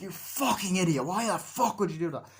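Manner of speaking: high, loud exasperation; heated mock outrage; acted berating. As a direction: tear into a foolish friend. Berating in retold quarrel.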